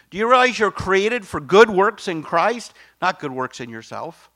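loud, ringing preacher's cry; full-force preaching. Real, not acted. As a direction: blast it to the back pews. Preaching climax to congregation.

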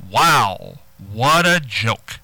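slow, drawn-out condescending praise; patronizing, unimpressed wow. Shown as a demonstration. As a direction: drag it out to belittle the joke. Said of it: "now that's condescending".

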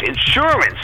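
insurance stressed on the middle syllable, in-SUR-ance; correcting with in-SUR-ance. Shown as a demonstration. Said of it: "S U R is the key".

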